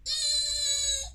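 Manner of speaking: very high, loud eerie squeal; long piercing little fairy scream; thin high-pitched screech. A narrator's reading. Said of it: "the little fairy screamed".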